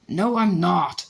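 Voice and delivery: sharp, loud denial, slowed; Harry hotly denies Malfoy's taunt, angry. A narrator's reading. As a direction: bite it back hard and angry. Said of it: "said Harry angrily".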